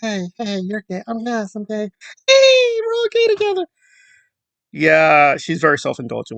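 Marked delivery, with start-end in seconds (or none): 0.0-1.9 soft, high-pitched cutesy mimicry; mock-coy put-on voices, quiet and high; mocking two video partners. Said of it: "where they're like". 2.3-3.6 very high falsetto squeal; hammed-up, piercingly high mock celebration; mocking two video partners. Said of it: "where they're like".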